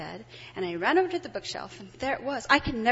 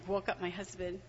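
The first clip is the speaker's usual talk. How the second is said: normal talking voice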